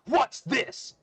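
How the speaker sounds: very loud, high demand; big shouted character voice; booming voice. Acted. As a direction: boom the question out. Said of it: "A loud booming voice".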